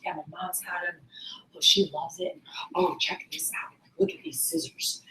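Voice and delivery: near-whispered, low mumbled chat; barely audible imitation of a soft-spoken man; imitating quiet talker. Trainer mimics a man's chat about his mom, very quiet. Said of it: "that's his loudness".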